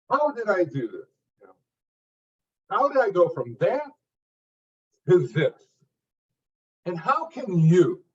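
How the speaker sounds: punchy, declarative speaker delivery; speaker's opening, short punchy phrases; short expressive phrases. Shown as a demonstration. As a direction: hit each short phrase with emphasis. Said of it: "Short, declarative phrases, very expressive".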